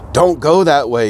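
mild storybook shout of warning; warning yell. Acted. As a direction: call out to stop her going the wrong way. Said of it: "yelled Chickapee to warn her".

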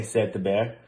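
ordinary delivery

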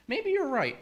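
very high, won-over Eve voice; persuaded Eve. Acted. Eve agreeing with the snake, high persuaded voice. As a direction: pitch it way up, then fall as you give in. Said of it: "she goes".

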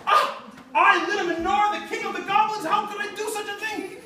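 high, loud, hammed-up goblin despair with a laugh; dismayed goblin wail. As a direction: wail like a villain who just got tricked. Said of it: "goblin tricked into lighting menorah".

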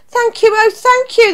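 high, gushing thanks; grateful cry. A narrator's reading. Witch thanks her animal rescuers, grateful cry. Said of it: "the grateful witch cried".

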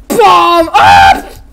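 high loud yell; battle yell. Retold or imitated. Toy fighter shouting in battle. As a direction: bellow the command. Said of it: "he was like".

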